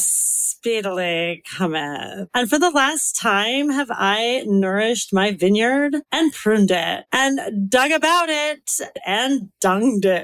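put-on very slow, drawn-out Valley girl; Valley girl accent. Shown as a demonstration. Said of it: "Southern California Valley girl accent with".

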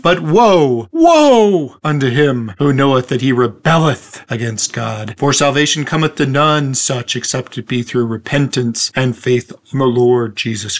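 a hint of a faith healer. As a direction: ramp it up like a preacher. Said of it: "starting to sound like a faith healer there".

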